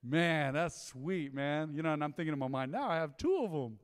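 ordinary talk, nothing marked

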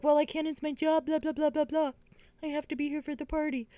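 high, dismissive mock voice, blah blah tossed off; mocking whiny excuse. Mocking Andy's excuse to her friend, high dismissive whine.

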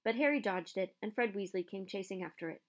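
ordinary delivery

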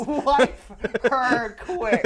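very slow, drawn-out catchphrase. Pretend. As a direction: savour each word slowly. Savours the new name.

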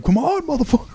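high-pitched mock challenger; squeaky-high taunt, hammed up; imitated taunting kid. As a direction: mimic a kid goading someone to fight. Kid challenging him to fight, imitated.

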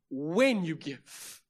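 When drawn out slowly; stress on When. Acted. Preacher driving the verse home to the congregation, stress on When. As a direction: lean long and hard on When.

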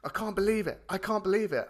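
dad phoning his son, thrilled and amazed; excited dad. Retold or imitated. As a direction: gush like a dad shaking with excitement. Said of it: "he was like".

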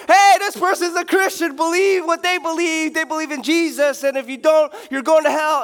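high, loud mock street preacher yelling; hammed-up announcing to the whole store. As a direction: follower shouting repent at Costco. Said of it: "you go to the library".

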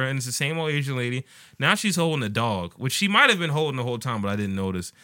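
normal talking voice

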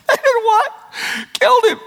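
high, squeaky disbelief; hammed-up high-pitched astonishment; mock incredulity. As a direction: play up amused disbelief, voice way up. Acts out incredulous Jesus trying not to laugh.

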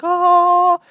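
long, high, loud angelic note; mock-heavenly "ahh". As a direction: hold one high choir-like note in parody. Mimics perfect, glowing movie Jesus.

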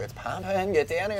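high, urgent hype; excited friend's call. Retold or imitated. Friend on the phone calling him down, excited. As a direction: hurry him down the beach. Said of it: "he was like".

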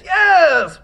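high, loud shout; cheering yes. Acted. Official cheering the right answer, a high loud shout.

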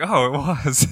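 hammed-up playful reveal; a mother wrapping up her fly-catching show for her daughters; mock triumph for the kids. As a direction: deliver it like a punchline to a young audience. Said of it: "doing a show for them".

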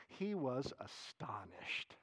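slow whisper full of wonder; awed whisper. Acted. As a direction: breathe it out in amazement. Reads verse in awe.

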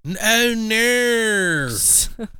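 very slow, drawn-out Australian "oh, no"; Australian accent. Retold or imitated. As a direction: stretch it out in a mock Australian accent. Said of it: "All of a sudden, she turned British".

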